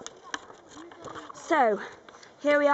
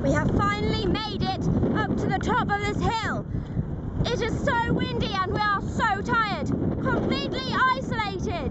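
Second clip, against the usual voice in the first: documentary narration; 2 semitones higher than usual. Acted. Dramatic survival documentary narration.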